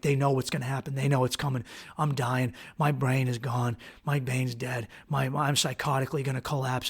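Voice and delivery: low, hushed stream of dread; quiet, fearful muttering to oneself; paranoid inner monologue. Retold or imitated. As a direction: run the fears on under your breath. Paranoid inner monologue, eyes closed.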